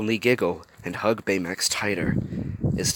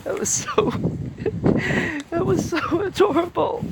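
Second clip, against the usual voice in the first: much higher, squealing over something cute; real delighted squeal; gushing. Real, not acted. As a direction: squeal at how adorable it is. Reader gushing over sweet scene.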